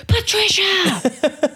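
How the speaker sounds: very high, slow drawn-out call; gym teacher yell. Retold or imitated. Gym teacher yelling for a pupil's attention. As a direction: bellow the name across the gym. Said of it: "that sound of the gym teacher".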